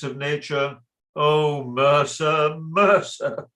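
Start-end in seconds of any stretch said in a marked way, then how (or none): 1.2-3.5 slow, drawn-out tut-tutting; pained reproach. Acted. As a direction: sorrowfully reproach an underling. Employer reproaches his clerk.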